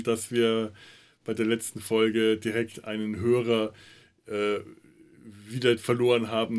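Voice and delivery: usual speaking voice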